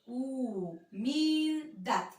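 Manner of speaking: Humildad very slow, each syllable drawn out. Shown as a demonstration. Teacher to pupils, slowly sounding out the word. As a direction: stretch out hu-mil-dad slowly. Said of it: "las átonas ahí".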